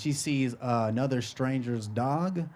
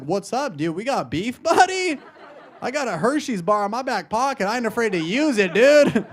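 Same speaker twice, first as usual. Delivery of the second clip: raised, puffed-up challenge; mock confrontational; 6 semitones higher, 3 dB louder than usual. Jealous boyfriend challenges a stranger's dog, mock confrontational. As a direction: square up with mock menace.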